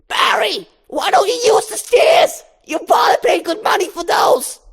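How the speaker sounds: high, loud nagging mom shout; scolding mother. Acted. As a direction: scold him for skipping the stairs.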